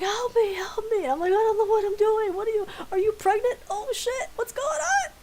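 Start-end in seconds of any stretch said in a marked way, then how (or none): 0.0-1.0 high, loud mock cry for help; imitated screaming. Roommate in pain calling from the toilet, screaming. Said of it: "she was screaming". 1.3-5.1 very high, loud, flustered squeal; mock panic. Friend not knowing how to help screaming roommate, mock panic.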